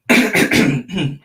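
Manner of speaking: loud throat-clearing cough; a hard cough to clear a frog in the throat; real cough. Real, not acted. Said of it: "Little frog in my throat".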